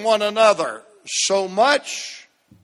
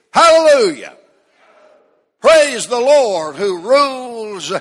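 full-force preaching; 5 dB louder than usual. Real, not acted. Pastor stirring the congregation on Palm Sunday, preaching hard. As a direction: build the church up to praise.

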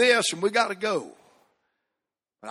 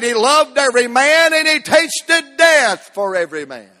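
loud, higher-pitched preaching climax; preaching at full force; 8 semitones higher, 6 dB louder than usual. Acted. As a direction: preach it out to the whole church.

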